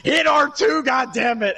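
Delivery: high shout at a gamer who won't listen; yelling at the TV. Retold or imitated. Gamer yelling at friend on screen, shouting. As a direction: bark the order at the screen, fed up. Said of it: "yelling at my T V".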